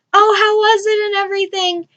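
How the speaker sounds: high-pitched imitated eager question; acted perky curiosity; eager asking. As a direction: ask like a keen relative, voice lifted. Retells relatives asking eagerly.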